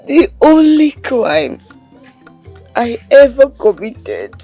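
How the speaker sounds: crying. Acted. Orphan telling her comforter nobody protects her, crying. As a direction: pour out the grief through sobs, haltingly. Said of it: "Stop crying".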